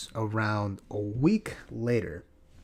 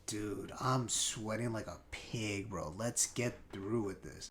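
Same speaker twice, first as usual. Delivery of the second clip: muttered; 3 semitones lower, 9 dB quieter, slower than usual. Retold or imitated. Quiet complaint in hot car.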